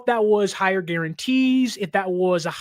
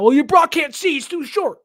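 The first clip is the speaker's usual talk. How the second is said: high, loud mock critic voice; a mocking take on fans nitpicking Brock; 7 semitones higher, 5 dB louder than usual. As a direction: parody the doubters, pitched way up and louder. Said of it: "a lot of people overblew that".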